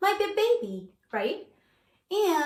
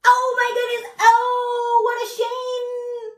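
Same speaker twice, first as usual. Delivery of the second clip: high, loud mock horror; shocked; 9 semitones higher, 5 dB louder, slower than usual. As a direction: gasp it out in shock. Reacting to bare baby bear.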